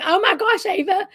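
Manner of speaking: mock-horrified, high-pitched sister; dismayed older sister.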